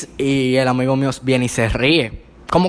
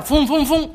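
engine noise imitation; 11 semitones higher, slower than usual. Mimicking the car's engine.

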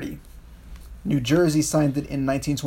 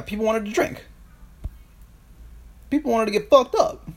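excited; 7 semitones higher than usual. Real, not acted. Excited emphatic point.

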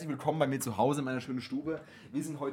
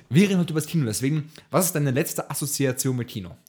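ordinary delivery

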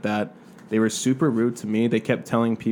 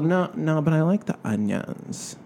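high, slow Valley whine; Valley girl accent; 6 semitones higher, slower than usual. Retold or imitated. Server mimics the picky customer in a Valley accent. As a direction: stretch it out like a spoiled Valley customer. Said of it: "she talked like this".

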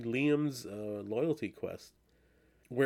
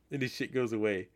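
ordinary delivery

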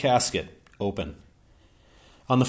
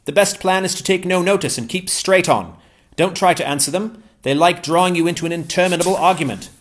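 higher-pitched, eager English gentleman; Ransom's voice; 9 semitones higher, 8 dB louder than usual. Acted. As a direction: pitch Ransom up, glad and keen.

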